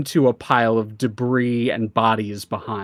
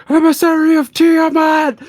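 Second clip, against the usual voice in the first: high, loud panicked cry; alarmed shout; 7 dB louder than usual. Acted. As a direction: yell it out in alarm. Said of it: "you hear a couple of people go".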